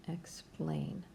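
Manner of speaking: soft breathy Explain under the breath; a quiet whispered repeat of Explain. Shown as a demonstration.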